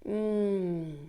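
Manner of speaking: long, contented "mm" of enjoyment; savouring mm. Acted. Someone stopping to savour yummy food, savouring hum. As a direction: linger on a hum, tasting something delicious. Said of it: "tasting food that's so yummy".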